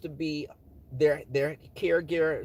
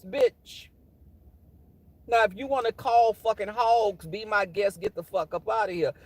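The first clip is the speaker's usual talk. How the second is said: higher, fired-up cussing out; Dwayne; 5 semitones higher than usual. Acted. Alter ego Dwayne cussing out a coworker, heated. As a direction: let loose a sassy, furious tirade. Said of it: "Dwayne was like".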